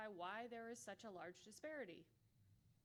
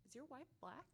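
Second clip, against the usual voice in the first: curious questioner; 3 semitones higher, 6 dB quieter than usual. Retold or imitated. People asking about wife.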